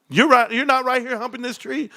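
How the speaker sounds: high, incredulous put-on voice; imitated wife. Wife texting her husband at the bar, high imitated disbelief. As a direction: question him in a high mock-wife voice. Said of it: "she's texting me".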